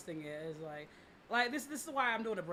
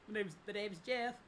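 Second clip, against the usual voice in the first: a hint of a put-on voice to hide who I am; lightly disguised, casual; mock disguise; 2 semitones higher than usual. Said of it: "I'll disguise my voice".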